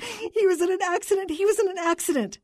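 high-pitched, tearful cries; distraught wife crying out; weeping wail. A narrator's reading. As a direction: wail it over and over. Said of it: "the voice whipped".

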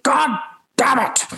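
high, drawn-out mock fury; mad outburst, imitated. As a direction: curse slowly and high, imitating someone furious. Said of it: "he got so mad".

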